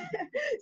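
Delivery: high delighted giggle. Real, not acted. Delighted laugh after story.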